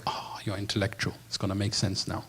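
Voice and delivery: mocking impressed. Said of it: "for me to think".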